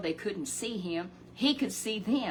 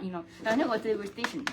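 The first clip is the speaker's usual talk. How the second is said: usual speaking voice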